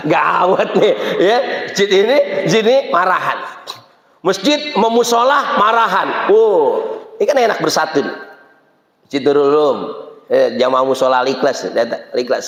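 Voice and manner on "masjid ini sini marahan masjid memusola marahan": usual speaking voice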